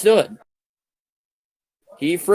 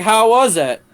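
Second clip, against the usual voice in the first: pitched up, louder, a mock curious dad; father's eager question; 7 semitones higher, 5 dB louder than usual. Father quizzing the narrator, in a pitched-up teasing voice. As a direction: play the nosy father asking for details. Father asks about the night.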